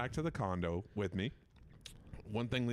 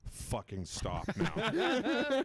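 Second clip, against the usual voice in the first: higher, drawn-out retold complaint; mild mock exasperation; 9 semitones higher, much slower than usual. Staff fed up with the voice by day three, mock exasperation. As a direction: stretch it out, pitched up, as fed-up listeners. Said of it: "they were like".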